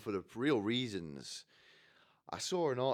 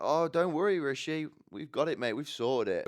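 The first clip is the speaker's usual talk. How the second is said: loud, higher-pitched mock reassurance; over-keen colleagues covering for the boss; brushing someone off; 7 semitones higher, 7 dB louder than usual. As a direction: wave the latecomer away, loudly. Colleagues brush off late leader.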